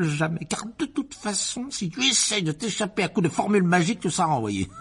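high, mocking triumphant threat; gloating villain. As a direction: gloat over the trapped boy. Vernon gloats over Harry.

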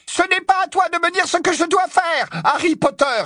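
shrill, furious shriek; voix suraiguë. Acted. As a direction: shriek indignantly at the top of your pitch. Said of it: "s'écria-t-elle d'une voix suraiguë". (cri furieux, suraigu)

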